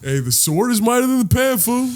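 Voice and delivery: high mocking retort; hammed-up high retort; mock retort, fool. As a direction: toss it back high, teasing. Mock correcting a misquote.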